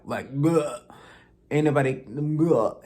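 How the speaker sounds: a loud retching bleh of disgust. Real, not acted. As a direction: spit out the bleh like a bad taste. Disgusted dismissive noise.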